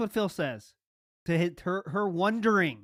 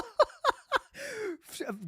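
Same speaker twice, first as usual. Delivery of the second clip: very high, wheezy laughter; high laughing fit; 4 dB quieter than usual. Real, not acted. Host cracking up at the clip, high laughing fit. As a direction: keep laughing helplessly, high up.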